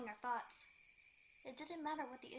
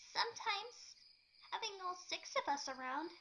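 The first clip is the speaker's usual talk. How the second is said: higher, trembling Pinkie voice; quivering voice; 7 semitones higher than usual. A narrator's reading. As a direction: let the voice quiver and fall away. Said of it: "in a quivering voice".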